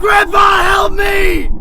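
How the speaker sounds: very high, loud panicked scream; shout for help. Acted. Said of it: "I shout".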